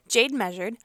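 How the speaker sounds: plain reading voice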